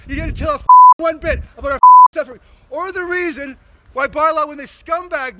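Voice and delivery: real angry yell; angry shout. Real, not acted. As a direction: shout your hurt at them. Angry protester confronting filmmakers.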